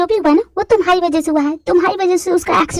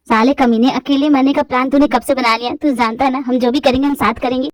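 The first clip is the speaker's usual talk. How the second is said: lower, rough teasing buddy; young man's voice; 6 semitones lower than usual. Acted. Friend scolding [name] about dying alone, lower voice. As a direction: scold your best friend fondly.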